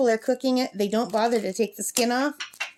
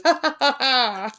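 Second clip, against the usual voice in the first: a hint of a cartoon-villain cackle; evil cartoon laugh; 3 semitones higher than usual. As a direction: imitate a Scooby-Doo villain cackle. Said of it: "like an evil laugh on a Scooby-Doo cartoon".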